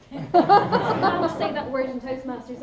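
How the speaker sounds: real laugh while apologising. Real, not acted. Speaker laughing at herself to the Toastmasters audience, laughing.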